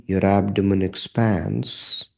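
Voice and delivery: ordinary talk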